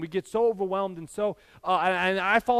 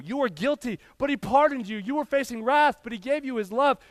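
higher-pitched rhythmic preacher's cadence; preaching litany; 5 semitones higher than usual. Acted. Pastor listing what God did for the church, higher preaching cadence. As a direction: ride the you-were, but-he rhythm.

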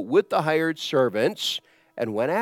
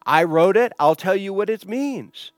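loud, higher-pitched firm declaration; authoritative author; 6 semitones higher, 7 dB louder than usual. Retold or imitated. The author explains his own writing, loudly authoritative. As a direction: state it as the one who wrote it.

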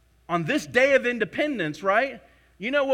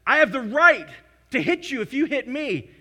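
mock shout; 3 semitones higher, 8 dB louder than usual. Voicing an entitled claimant.

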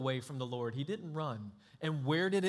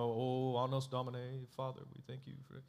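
softer, quick churchy recital; mock formal prayer; 4 semitones lower, 5 dB quieter than usual. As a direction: parody a polished, empty prayer. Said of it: "we sanitize our prayers".

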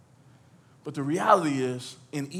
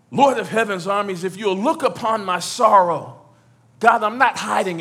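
louder, pleading prayer cry; loud anguished prayer; 5 semitones higher, 8 dB louder than usual. Acted. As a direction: Lord of heaven's armies cried out loud and raw. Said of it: "cry it out and weep it out, but still pray".

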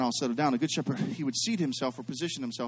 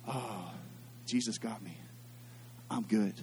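much softer and lower, unhurried; soft low murmur of trust; softly relieved; 5 semitones lower, 11 dB quieter, slower than usual. Retold or imitated. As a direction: let it sink out quietly, content. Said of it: "we're like".

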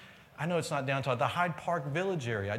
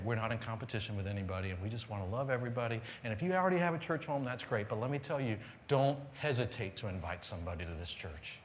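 lower, settled reassurance; calm; 5 semitones lower, faster than usual. Acted. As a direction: bring it down and say it calmly, without pauses. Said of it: "you can kinda calmly say".

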